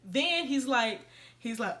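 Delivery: usual speaking voice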